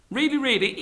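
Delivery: high-pitched double-check; nervous Brahmin. Acted. Brahmin opening the cage door, nervous. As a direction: ask high and worried.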